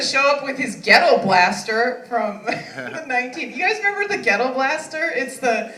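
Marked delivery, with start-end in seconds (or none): none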